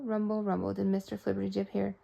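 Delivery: usual speaking voice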